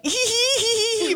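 high tittering hee hee; high-pitched frightened tittering; nervous scared giggle. Retold or imitated. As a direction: giggle out of pure nerves. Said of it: "I start laughing 'cause I'm scared".

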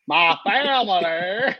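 slow, drawn-out put-on Southern drawl; fake Southern accent. Host mimics Brian Kelly's bad Southern accent, drawled out. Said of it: "is that a Cajun accent?".

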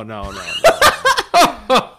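mock Santa no, no, no; Santa laugh. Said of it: "pretty good Santa laugh".

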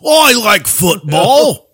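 slow, high, loud mock British accent; drawn-out hammed British voice; exaggerated British accent, stretched out. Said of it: "Say it like a stereotypical British guy".

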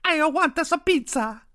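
high, loud cartoon Italian Mario voice; fake Italian accent. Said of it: "Chris Pratt kept going".

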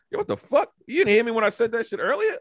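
high-pitched indignant outburst; indignant self-quote. Retold or imitated. Man replays scolding someone in his texts, indignant and high. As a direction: demand an answer, pitched well up. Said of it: "I'd be like".

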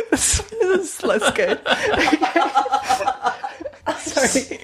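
giggling through the words; slow, breaking into laughter; laughing. Real, not acted. Laughing at own bad pun.

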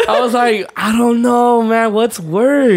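laughing. Real, not acted. Laughing agreement.

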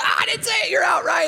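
high, loud gotcha shout; hammed-up playground call; mock kid shout. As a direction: yell like a kid catching someone out. Kid calling another out.

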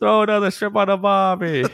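high, loud-ish cartoon Australian accent; bad Australian accent. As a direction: send up an Australian accent, badly. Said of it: "the worst Australian accent".

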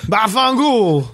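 mock Italian-American exclamation; hammed-up fake Italian. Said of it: "the fingers under the chin".

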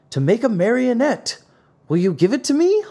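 put-on high, wheedling old-man character; pleading Geppetto. A narrator's reading. Geppetto asks the carpenter for wood, high pleading character voice. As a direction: ask very high, lifting at the end.

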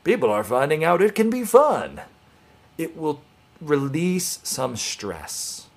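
chirpy vintage narrator; old-timey voice. Retold or imitated. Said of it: "in that old-timey voice".

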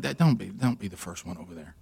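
imitating his wife hissing at him to stay back; hushed warning. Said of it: "she's like".

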